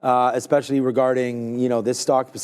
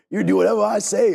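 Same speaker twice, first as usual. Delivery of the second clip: imitating a bossy car dealer, pitched up; Will Ferrell impression; 6 semitones higher than usual. Retelling a car dealership scene, mock bossy impression. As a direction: quote the dealership boss in a higher voice. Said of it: "it's Will Ferrell, and he's like".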